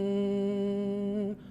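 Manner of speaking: soft low closed-lip hum; hummed M. Shown as a demonstration. Coach leading the class hum, soft hum. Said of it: "we'll do that another time".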